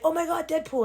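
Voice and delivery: mock-excited, high-pitched gush; gushing fan. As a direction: imitate an overexcited fan. Mimics everyone gushing over Deadpool.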